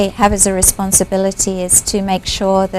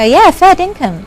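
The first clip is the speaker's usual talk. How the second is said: casual Aussie reply; 9 semitones higher than usual. Retold or imitated. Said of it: "and I go".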